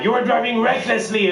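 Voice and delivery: high-pitched, indignant mock outburst; imitated angry cop. Comic imitating the cop at a traffic stop, really mad. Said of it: "He was getting really mad".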